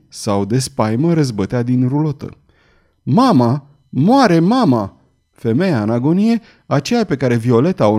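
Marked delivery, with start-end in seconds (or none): 3.0-5.0 drawn-out, anguished cry; alarmed cry. A narrator's reading. Girl sees her mother dying, alarmed cry.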